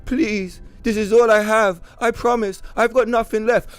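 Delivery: high, pleading hammed-up clerk; mock begging. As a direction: beg like he's about to cry. Voicing the begging worker.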